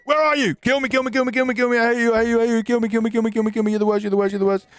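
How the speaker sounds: loud, high taunting yells; shouting. Pretend. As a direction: scream taunts to provoke the ghost. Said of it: "let's try shouting at it".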